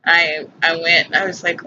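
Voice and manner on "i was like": ordinary talk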